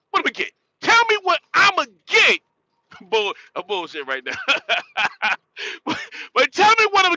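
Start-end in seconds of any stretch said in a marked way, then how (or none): 0.1-2.4 high and loud, daring them to answer; taunting shout. Real, not acted. Vlogger daring critics to say what arguing gets him. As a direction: throw it out as a challenge.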